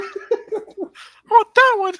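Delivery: put-on high, slow whiny kid voice; childish demanding voice. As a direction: drag it out like a greedy child.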